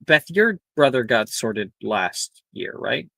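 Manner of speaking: ordinary talk, nothing marked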